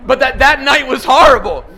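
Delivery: loud, high, driving sermon; impassioned preaching. Acted. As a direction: build the congregation up to the peak. Preaching builds to full force.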